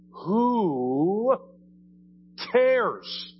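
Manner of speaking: high, drawn-out exasperated outburst; emphatic exasperation. Real, not acted. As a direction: stretch each word out, pitched high, to dismiss it.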